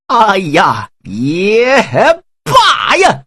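wrestle with the decision, then give in; wavering then resolved. Acted. Huang Feihu talks himself into rebellion, rising to a cry.

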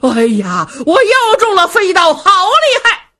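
high, loud cry of alarm; shouting on the run. A narrator's reading. Wang Bodang yells as he flees the flying knife, high shout. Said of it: "一边跑一边喊".

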